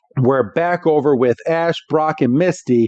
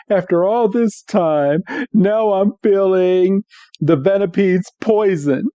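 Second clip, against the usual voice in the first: slow, higher, drawn-out mock suffering; poisoned Meowth; 6 semitones higher than usual. Meowth announces the poison is working, mock suffering. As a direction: drag it out like a hammed-up victim of poison. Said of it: "Meowth is dying on the ground".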